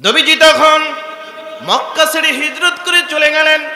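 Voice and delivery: high, loud, chanted sermon cry; impassioned preaching. A narrator's reading. Preacher tells the crowd of a convert crying to his Lord, high loud chant. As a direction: preach it out loud and high, drawing out phrase ends. Full-force sermon narration.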